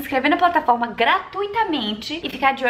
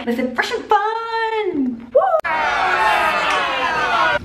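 high, drawn-out fashion cheer; excited; 7 semitones higher, 5 dB louder, much slower than usual. Acted. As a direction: sing it out like a diva. Anuncia o evento com entusiasmo. (animada e arrastada, bem aguda)

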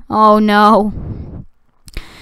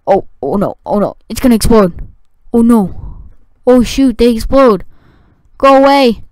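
usual speaking voice

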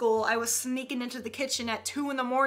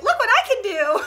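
higher, louder boastful call; show-off employee; 7 semitones higher, 5 dB louder than usual. Acted. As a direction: show off like an overeager worker. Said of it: "Dairy Queen employees, like".

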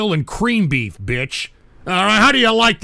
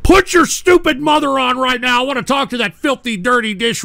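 higher, louder command; angry demand; 7 semitones higher, 6 dB louder than usual. Real, not acted. Host yelling at a prank caller to get a parent. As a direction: demand the mother now.

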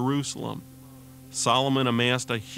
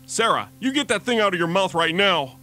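high, loud scolding call across the store; loud, exasperated mother's call; mom calling out sharply; 7 semitones higher, 5 dB louder than usual. Acted. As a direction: yell at a child to take something out of her mouth. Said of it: "she heard a small voice cry out".

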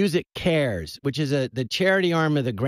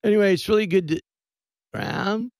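spaced-out [name]; 5 semitones higher than usual. Retold or imitated. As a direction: lose the thread like you're out of your skull. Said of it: "I'm playing it, like, just out of my skull".

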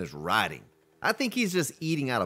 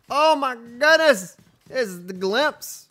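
surprised; 10 semitones higher, 6 dB louder, much slower than usual. Real, not acted. Spots a rare creature.

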